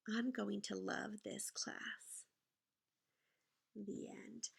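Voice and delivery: soft, slow whispered ending. A narrator's reading. Teacher reading to her class, whispered ending. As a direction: breathe the final line.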